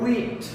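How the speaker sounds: teacher leading the repeat of huit. Shown as a demonstration. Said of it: "Repite conmigo".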